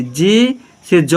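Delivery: slow, deliberate letter-by-letter; slow spelling. Shown as a demonstration. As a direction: spell जे से ज very slowly. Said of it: "teacher spells letter slowly". (बहुत धीरे spelling)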